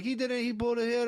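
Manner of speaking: put-on high-pitched old Jamaican men protesting; mock Jamaican accent. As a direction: garble it like fired-up Jamaican elders. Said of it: "they're just these old guys".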